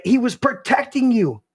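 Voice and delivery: high, driving preacher's voice; full-force preaching. Acted. Pastor preaching at full force to his listeners on the show. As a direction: preach it hard to the room.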